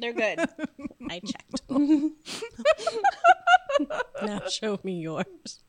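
slow, laughing tease. Real, not acted. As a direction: drawl it out while laughing.

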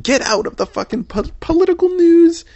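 high, loud burst of annoyance; exasperated yell. Real, not acted. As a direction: voice jumps high and loud on the last words. Exasperated outburst.

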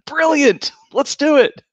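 castle owners cheering their own marketing pitch; mock excitement. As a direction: cheer like a schemer who just struck gold. Acted lords cheering the scheme.

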